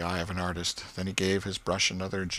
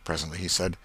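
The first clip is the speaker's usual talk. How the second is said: ordinary delivery, nothing marked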